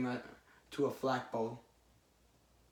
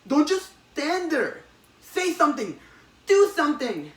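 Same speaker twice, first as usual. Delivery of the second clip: urgent yell; 11 semitones higher, 7 dB louder than usual. Retold or imitated. Retelling the comic, a voice yells at frozen Peter. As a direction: bark the orders in a panic. Urgent command in retold scene.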